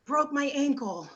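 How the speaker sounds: faking a sick voice on the phone; fake sick call. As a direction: put on a weak sick voice. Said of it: "It would be like".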